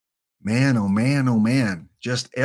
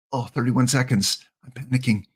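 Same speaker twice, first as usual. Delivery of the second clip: normal talking voice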